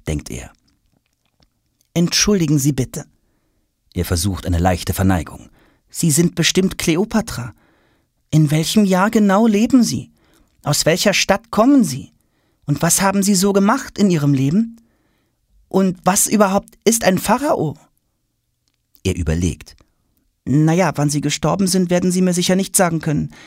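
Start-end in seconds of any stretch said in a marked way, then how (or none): 1.9-17.8 put-on high, bright-eyed boyish questioning; a curious schoolboy firing questions; eager boy Tom. Schoolboy Tom pestering the silent queen with questions. 20.5-23.3 put-on high, bright-eyed boyish questioning; a curious schoolboy firing questions; eager boy Tom. Schoolboy Tom pestering the silent queen with questions.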